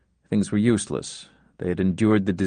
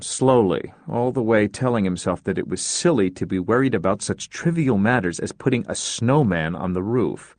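usual speaking voice

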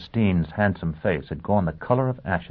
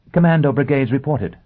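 urgent excited; 8 semitones higher, 7 dB louder than usual. A narrator's reading. Urgent news bursting in.

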